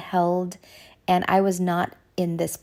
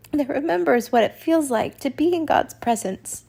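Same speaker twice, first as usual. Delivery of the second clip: starting to cry; 6 semitones higher, 3 dB louder than usual. Real, not acted. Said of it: "I'm gonna start crying".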